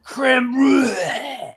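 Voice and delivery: drawn-out, strained, high Italian impression; hammed-up Italian gasping out a dessert; mock running out of air. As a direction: stretch it very slowly until the breath gives out. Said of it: "He's, like, running out of air".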